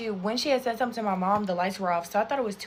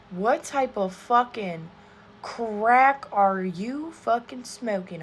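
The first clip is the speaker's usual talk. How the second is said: each word dragged out slowly; slow outrage; 4 dB louder, much slower than usual. Real, not acted. Outraged at the girl who shushed her mom, drawn out. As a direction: spell out the disbelief, slowly.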